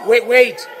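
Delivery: usual speaking voice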